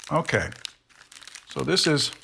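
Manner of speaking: normal talking voice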